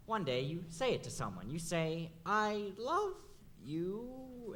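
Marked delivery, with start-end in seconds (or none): 2.3-4.5 "I love you" rising higher like a question. Retold or imitated. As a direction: ask it, unsure of the answer. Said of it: "you basically phrase it as a question".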